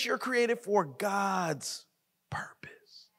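fades to a hushed whisper on purpose; hushed dramatic whisper; whispered emphasis. Real, not acted. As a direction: drop the voice to a breath at the end.

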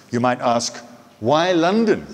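ordinary delivery

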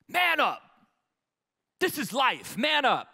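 high, loud retold yelling; harsh yell. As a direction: shout it down at the boy. Brothers beating him, yelling.

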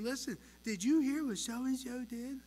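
hammed-up tattling preschooler. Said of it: "Why am I talking like that?".

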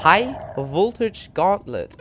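slow, loud, grand level announcement; showman announcement. Acted. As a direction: announce it like a show host. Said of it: "bereite dich vor für eine Lichtshow".

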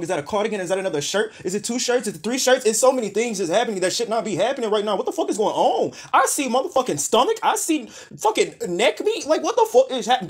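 climbing into disbelieving shrieks; laughing outrage rising; escalating disbelief. Real, not acted.

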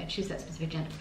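usual speaking voice

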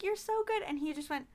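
ordinary talk

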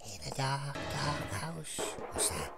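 very soft, low near-whisper; barely voiced, wary murmur; hushed creeping. Real, not acted. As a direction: whisper it while creeping through a dark house. Creeping through dark game house.

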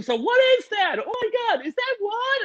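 very high squeaky confused voices; mock shrill parents. Parents shouting questions at the host, high mocking squeak. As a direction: poke fun at the panicked parents. Parents yelling on Zoom, mocked.

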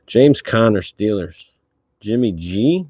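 usual speaking voice

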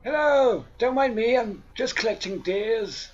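high, innocent sing-song greeting; mock cheerful. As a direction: greet them far too casually.

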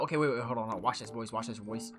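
low, hushed aside to the team. Real, not acted. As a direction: keep it down, sneaking up. Sneaking up, hushing the team.

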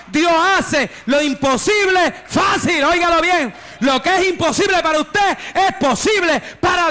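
rising preacher's cry that God makes the impossible easy; fervent preaching. Real, not acted. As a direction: preach it at full force, climbing to a shout. Preacher building to full force.

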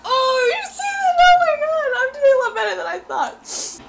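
very high, thrilled outburst; elated squeal. Real, not acted. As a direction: squeal with surprise at your own win. Celebrates winning the round.

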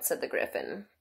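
ordinary delivery, nothing marked

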